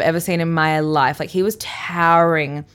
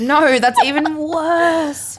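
higher, slow whiny protest; mock disgust; 8 semitones higher, much slower than usual. Real, not acted. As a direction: reject the name dramatically. Rejecting the name Todd.

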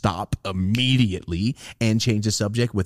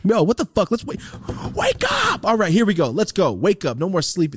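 hyped wake-up call; 8 semitones higher than usual. Acted. Waking up the sleepy podcast.

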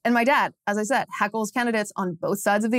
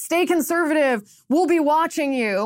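mock heckle called out higher; teasing heckle; 6 semitones higher than usual. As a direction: call it out like a teasing warning from the curb. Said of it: "heckles him and says".

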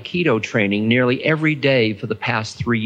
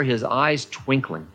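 plain reading voice

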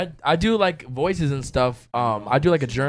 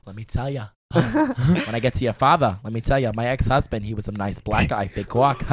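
lower, softer New Jersey mom voice; Linda; 4 semitones lower, 4 dB quieter than usual. Pretend. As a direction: play a confiding Jersey mom. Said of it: "I like doing Linda. She's like a mom".

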